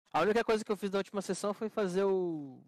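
loud. Real, not acted. Loud heated explanation.

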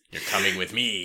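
mock cop. Child cop arresting the teacher, low mock-stern voice. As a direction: play a stern policeman. Playing little undercover cop.

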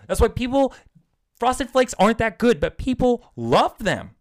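ranting with rising heat. Real, not acted. Host ranting about Frosted Flakes to his cohost, heated. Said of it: "I'm getting too heated right now".

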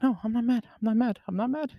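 high, soft, repeated reassurance; soothing reassurance. Retold or imitated. Driver retells calming panicking friend, high soft reassurance. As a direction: placate gently in a high little voice.

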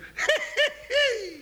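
Jingles' high cackle; high wheezy laugh. Acted. Jingles laughing at his own snake joke, high laugh.

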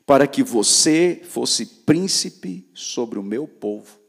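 loud, slow preaching of the verse; forceful scripture reading. Acted. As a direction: proclaim each word slowly to the crowd. Reads scripture loudly. (leitura bíblica pregada, alta e lenta)

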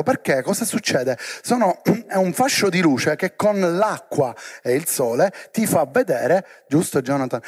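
ordinary talk, nothing marked

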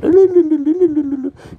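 high, rapid "lululu" guitar noodling; loud mouth-made guitar run; imitated guitar riff. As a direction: trill the tongue like fingers running on frets. Imitates fast guitar fingering.

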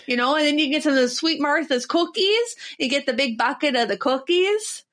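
hammed-up, folksy Minnesota accent. Fairgoer chatting about Pronto Pups, folksy Minnesota accent. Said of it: "Oh, Minnesota".

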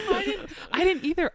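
laughing through the words; nervous laugh while telling it. Real, not acted. Laughing with the host about not knowing.

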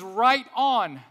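loud, high shout of approval; approving cheer. Acted. Preacher cheers the poor in spirit, loud approving cheer. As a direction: cheer them on at full voice.

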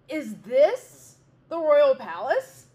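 loud, slow mocking cry; bear cries out. A narrator's reading. Bear sneering at the wren nest, loud slow cry. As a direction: bear shouts his scorn at the nest. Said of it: "cried the bear".

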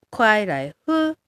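快来ふ drawn out slowly as Mandarin kuài lái hē. Shown as a demonstration. Teacher modelling 快来ふ for learners, slow and careful. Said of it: "ふにすると飲む".

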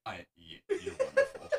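can barely talk for laughing. Real, not acted. Fit of laughter.

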